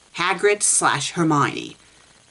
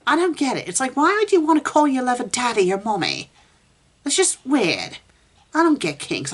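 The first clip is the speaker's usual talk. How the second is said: exasperated disbelief; 8 semitones higher than usual. Real, not acted. Exasperated rant about kinks.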